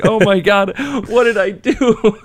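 high, slow, laughing embarrassment; retold mortification, laughing, pitched high; mortified laughing. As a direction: laugh through your own dismay at the mistake. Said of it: "And I'm just like".

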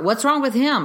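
imitating an uncomprehending onlooker; mimicked baffled judgement. Mother mimicking people who don't understand trauma, judgemental and baffled.

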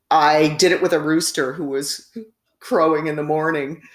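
ordinary talk

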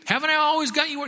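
high, loud, wounded donkey plea; aggrieved talking donkey. Acted. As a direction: plead your case as a hurt donkey. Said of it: "Donkey said".

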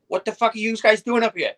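high, loud and far from his own voice; angry husband. Retold or imitated. Husband with golf club yelling at roof trespassers, mock angry. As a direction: bark at the kids on his roof.

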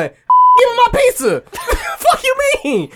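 loud, high, furious retold rant; angry yelling. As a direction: shout at the late delivery guy. Retold yelling at pizza guy.

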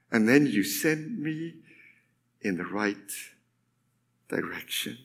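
fading low and very soft, with long pauses; hushed psalm reading. Acted. Preacher closing the psalm verse, hushed. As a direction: let each phrase sink towards a hush.

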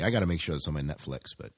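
ordinary talk, nothing marked

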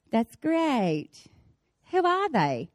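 soft, polite non-reaction; quietly acted lack of interest; unimpressed husband. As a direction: imitate a husband mildly humouring someone. Imitates husband's unimpressed nodding reply.

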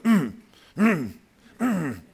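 high, sulky hums through closed lips; toddler's sulky hums. Retold or imitated. Toddler pouting at grandpa, sulky mm hums. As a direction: hum like a pouting two-year-old. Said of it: "she was like".

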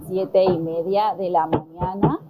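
normal talking voice

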